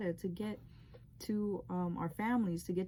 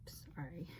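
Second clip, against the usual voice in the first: a soft, voiceless sigh, then a much quieter sorry; pausing, moved, apologizing to viewers; real emotional sigh; 2 semitones lower, 14 dB quieter than usual. Real, not acted. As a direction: gather yourself, barely voiced. Teller overcome while recalling dream.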